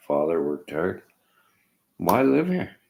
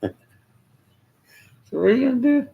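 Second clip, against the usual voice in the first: laughing; 7 dB louder, slower than usual. Real, not acted. Laughs at political broken promises.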